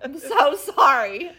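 high, loud laughing plea; laughing apology. Pretend. Laughing apology to the Lord over her bad morning. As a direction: beg forgiveness while laughing.

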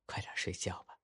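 soft, breathy goodnight; mother whispering her son to sleep; hushed bedtime whisper. Acted. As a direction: whisper softly to a sleepy child.

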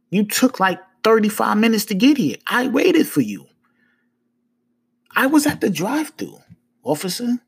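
high-pitched, insistent protest; talking like this. Retold or imitated. Man protesting to a late officer, high and insistent. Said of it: "I'm talking to him like this".

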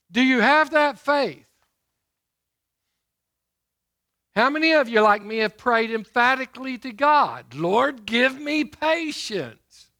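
loud, ringing pulpit delivery; booming sermon voice; preaching at full force. Acted. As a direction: preach it out to the congregation. Preacher rouses congregation.

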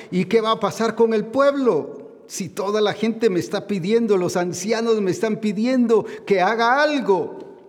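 a preacher voicing Samuel's panic; anxious Samuel. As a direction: fret aloud as the troubled prophet. Samuel voiced, worried about people.

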